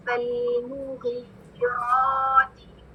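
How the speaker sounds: फलमुग़ीराति recited very slowly; slow recitation. Shown as a demonstration. Teacher recites so students notice mistakes. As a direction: draw out फलमुग़ीराति syllable by syllable. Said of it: "इसको कैसे पढ़ते हैं".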